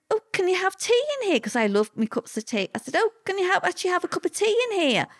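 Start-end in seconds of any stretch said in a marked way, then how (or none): 0.1-1.4 bright, much higher voice, as if amazed; high, eager disbelief; surprised. Retold or imitated. Retelling her surprise at prison tea. 3.0-5.1 bright, much higher voice, as if amazed; high, eager disbelief; surprised. Retold or imitated. Retelling her surprise at prison tea.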